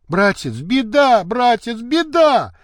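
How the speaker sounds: high, alarmed wail for help; distressed high-pitched outcry, rising on the repeat; crying out. A narrator's reading. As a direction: cry out disaster to your brother. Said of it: "кричала она".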